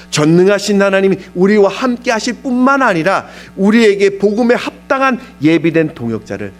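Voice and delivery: loud, high sermon delivery; preacher at full force; impassioned preaching. Real, not acted. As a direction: drive the promise home to the congregation.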